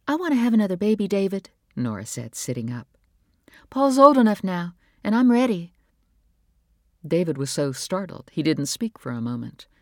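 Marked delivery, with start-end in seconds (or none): 0.1-1.5 fast, higher, louder eager rush; eager wife. A narrator's reading. Wife telling husband she wants another baby, eager and fast. Nora's voice in the story. 3.7-5.7 fast, higher, louder eager rush; eager wife. A narrator's reading. Wife telling husband she wants another baby, eager and fast. Nora's voice in the story.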